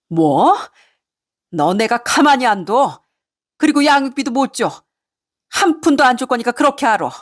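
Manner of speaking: high, threatening mother-in-law rant; acted outrage, pitch jumping on each refusal; angry yelling. Mother-in-law threatens to withhold child support, yelling angrily. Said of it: "그렇게 고래고래 소리치는 시모의".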